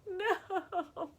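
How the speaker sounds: high drawn-out mock wail; mock dismay. Fan imagines fall without sports, high mock wail. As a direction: whimper it high in hammed-up grief.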